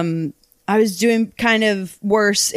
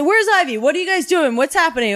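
higher-pitched imitation of a worried dad; urgent questioning dad; 9 semitones higher, faster than usual. Dad asking counselor about daughter, mock urgent. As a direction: fire off a parent's questions.

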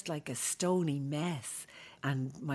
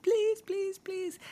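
put-on high pleading child's begging; childish pleading; slower than usual. Little girl begging her father to save the goats, high pleading.

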